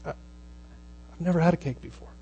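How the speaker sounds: a hushed, choked-up confession; tearful whisper. Retold or imitated. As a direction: breathe it out, overwhelmed. Stunned birthday girl, tearful.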